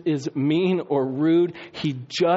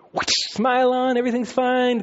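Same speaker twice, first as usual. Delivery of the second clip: fake church smile; 8 semitones higher than usual. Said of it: "it's fake time".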